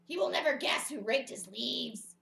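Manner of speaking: loud storybook Toad voice; gleeful Toad. Acted. Toad delights in his secret surprise, loud and gleeful. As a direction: crow it loudly as Toad.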